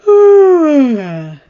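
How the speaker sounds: put-on a haughty young prince sneering, lifted and loud. Prince taunts Robb.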